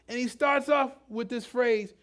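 normal talking voice

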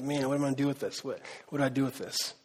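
low, soft self-questioning; puzzled hearer. Acted. Churchgoer wondering what to do with the gospel, puzzled. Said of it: "right now you're like".

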